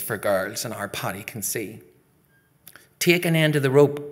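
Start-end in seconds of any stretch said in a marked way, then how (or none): 3.0-4.1 raised, louder command; bossy order. Acted. Girl ordering a boy onto the rope, bossy. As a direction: order it briskly from the start. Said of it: "Bridge orders".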